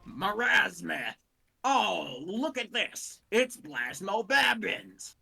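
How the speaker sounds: loud, hammed-up dim soldier. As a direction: proudly announce the botched names, drawn out. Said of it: "Soldier just completely mispronounces all the names".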